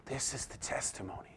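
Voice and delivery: whisper. Real, not acted. Hushed line after the proclamation.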